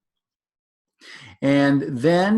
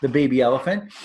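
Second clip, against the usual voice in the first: ordinary delivery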